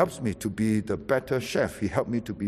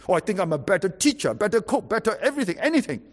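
rising, faster, bragging list; brag, climbing higher with each item; boastful, high-minded; 8 semitones higher, faster than usual. Retold or imitated. Preacher mocking a proud believer, bragging ever higher. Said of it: "High-minded".

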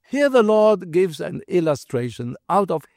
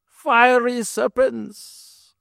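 dramatic slow; 5 semitones higher, much slower than usual. Real, not acted. Dramatic reveal in sermon.

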